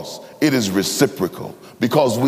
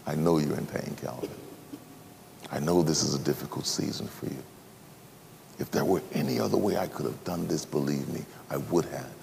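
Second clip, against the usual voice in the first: soft, low, gentle consolation; tender comfort; 6 semitones lower, 11 dB quieter than usual. Acted. Jesus consoling Calvin in his pain, soft tender voice. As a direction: speak softly as a comforting friend.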